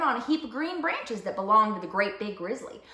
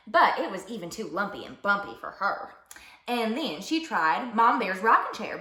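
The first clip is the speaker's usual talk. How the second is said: ordinary delivery, nothing marked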